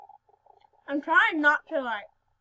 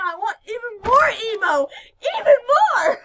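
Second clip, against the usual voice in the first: excited; 7 semitones higher, 11 dB louder than usual. Real, not acted. Gushing about emo dens.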